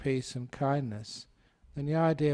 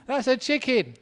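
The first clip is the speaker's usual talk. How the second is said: much higher, louder hammed-up wife; high mock wife; 10 semitones higher, 5 dB louder than usual. Wife insisting to her husband it's a chicken, high mock voice. Repeats wife's line after laughter.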